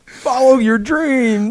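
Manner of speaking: high, loud, slow mock inspiration; cheesy inspirational line. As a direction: ham up a cheesy motto. Riffing corny autograph message.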